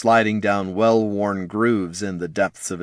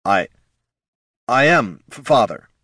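nervous stammer; 4 semitones higher, 4 dB louder than usual. Acted. Jin stammering before father.

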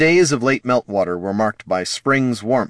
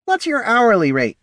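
character voice; 4 dB louder than usual. A narrator's reading. Poldo asks, character voice.

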